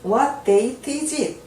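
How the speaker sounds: very slow, every word laid out; slow model reading. Shown as a demonstration. Teacher to learners, very slow model question. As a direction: spell it out slowly for learners. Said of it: "teacher models English question".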